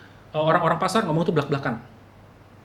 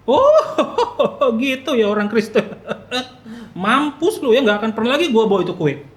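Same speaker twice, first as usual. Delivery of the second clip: high, loud mock-laughing retort; offended sarcasm; 6 semitones higher, 7 dB louder than usual. Offended cake-bringer reacting to the guest, loud sarcastic indignation. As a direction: burst out high with a scornful laugh, then stay loud.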